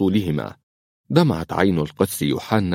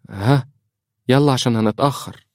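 higher-pitched, flustered hurry-up; flustered interruption; 6 semitones higher than usual. Acted. Priest cuts his daughter off to leave, flustered. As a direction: cut in with pitch raised, distracted. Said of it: "ليقاطعها يوحنا مشتتا".

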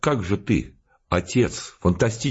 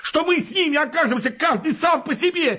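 high, frantic shouting rant; furious yelling. Acted. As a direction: yell it at him in a fury, pitch way up. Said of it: "Он так бешено орал и брызгался". (бешеный крик)

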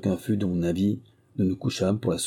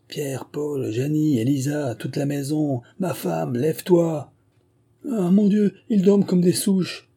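mounting exasperated summons in a raised pitch; calling to rouse everyone; 7 semitones higher than usual. A narrator's reading. Innkeeper rousing the household.

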